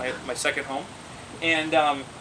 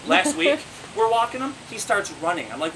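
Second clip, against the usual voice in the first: normal talking voice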